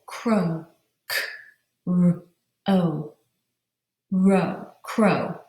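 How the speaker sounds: "K R O" as separate sounds, blended into "crow"; "crow" broken into single sounds and rejoined; sounded out. Shown as a demonstration. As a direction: sound out "K", "R", "O", then build "Row" and "crow". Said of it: "The second syllable".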